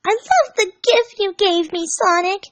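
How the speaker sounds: put-on high, slow adoring girl voice; Amy voice. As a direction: gush like a lovestruck girl.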